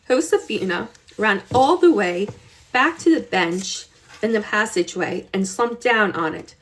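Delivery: ordinary delivery, nothing marked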